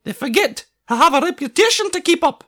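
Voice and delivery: high, loud indignant Scottish voice; high Scottish painter fuming about painting monsters; cross Scottish artist. A narrator's reading. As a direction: scoff at the job, offended. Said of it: "said Angus crossly".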